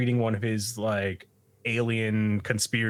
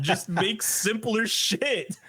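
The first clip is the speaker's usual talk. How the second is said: high, drawn-out frustrated retort; exasperated demand; 9 semitones higher, slower than usual. Retold or imitated. Takakura to his partner about the robot, exasperated. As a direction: demand it slowly, pitch raised. Retold bickering over robot design.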